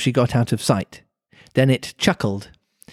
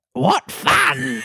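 very high squawky creature voice; Griffin voice. Acted. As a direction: squawk it out like a bird. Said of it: "Then it chuckled".

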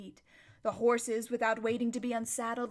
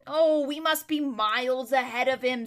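confident horse; 3 semitones higher, 7 dB louder than usual. A narrator's reading. Bree voiced.

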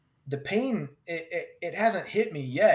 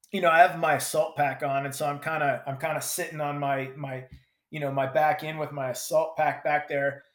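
normal talking voice

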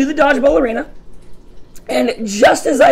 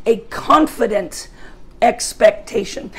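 slow, deliberate hope is a confident expectation; slow definition; slower than usual. Shown as a demonstration. Preacher dictating a definition for note-takers, slow. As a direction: lay out each word slowly for note-takers. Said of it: "just write down".

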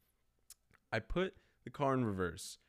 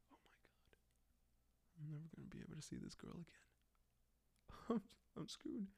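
very soft, stunned self-talk; tiny, hushed voice of someone doomed; whispered dread; 5 semitones higher, 15 dB quieter than usual. Retold or imitated. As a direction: whisper it as doom sinks in. Retold panic on seeing damage.

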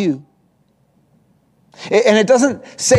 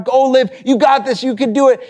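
excited cheer; 6 semitones higher than usual. Retold or imitated. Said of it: "cheering her on".

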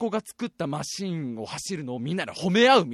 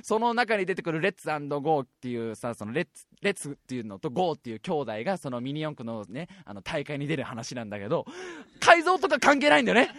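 usual speaking voice

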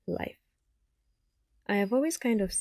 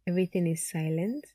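ordinary delivery

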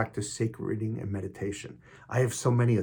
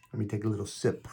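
ordinary delivery

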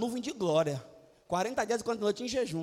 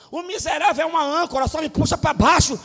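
mock complaint; 5 semitones higher, 10 dB louder than usual. Preacher mocks clingy friend.

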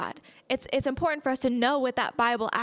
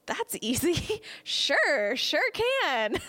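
giggling, higher and bright with joy; laughing; 6 semitones higher, much slower than usual. Retold or imitated. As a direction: laugh through the answer. Laughing reply in retold scene.

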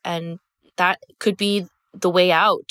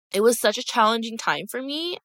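ordinary delivery

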